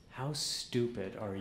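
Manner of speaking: slow, deadpan, mocking her words; deadpan comeback. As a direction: throw her insult back slowly. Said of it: "and I said".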